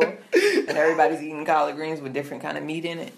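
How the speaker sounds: loud, laughing through the explanation; laughing while talking. Real, not acted. Host explaining the festival's food to co-host, laughing. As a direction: finish the thought while cracking up.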